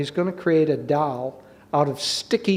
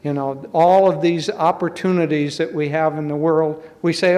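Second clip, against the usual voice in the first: normal talking voice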